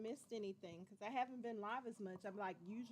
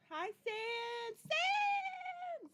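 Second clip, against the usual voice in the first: much higher, drawn-out call of the name; excited hello; 5 dB louder, much slower than usual. Real, not acted. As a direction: sing out the name in delight. Greeting a viewer on live stream.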